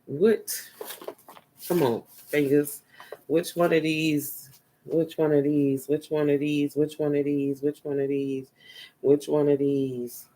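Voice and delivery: low, soft, repeated under the breath; absent-minded murmur while searching; muttering to self. Real, not acted. Talking to self while choosing which one, low absent-minded mutter.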